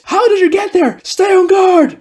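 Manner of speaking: high, loud panicked shouts; spooked scream. Acted. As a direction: cry out in alarm to the others. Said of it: "members of the platoon scream".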